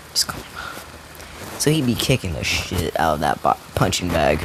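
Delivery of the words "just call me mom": soft whispered repeat; whispering. Retold or imitated. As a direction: whisper it like a quiet aside. Repeats the line to the boy.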